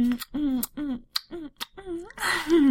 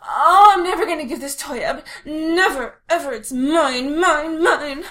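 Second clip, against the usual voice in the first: possessive whining; 3 semitones higher, 7 dB louder than usual. Acted. Giantess clinging to her toy.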